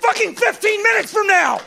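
very high, strained comic ranting; exasperated yell. Acted. Comic ranting about how kids temp is made, high yell. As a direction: build a rant at the top of your voice.

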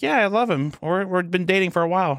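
put-on high, gushing teen girl voice; smitten schoolgirl. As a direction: gush about her boyfriend. Smitten student Sarah.

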